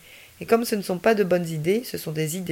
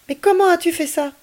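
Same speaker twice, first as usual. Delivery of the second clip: much higher, louder astonished question; the boy asking Ami how he froze the police, amazed; amazed child; 11 semitones higher, 5 dB louder than usual. Acted. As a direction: marvel at the trick.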